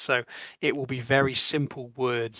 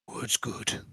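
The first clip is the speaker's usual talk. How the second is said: grunting mammoth voice; 2 semitones lower, 6 dB quieter than usual. Acted. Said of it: "large grunting noise".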